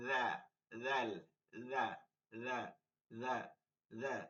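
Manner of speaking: "রা" and "জাল" as a buzzed th, tongue tip to teeth; isolated voiced th syllables, one at a time; dental Arabic dhal. Shown as a demonstration. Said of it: "আগার সাথে জিব্বার আগাটা মিলে". (জিভের আগা দাঁতে ছুঁইয়ে "যা" উচ্চারণ)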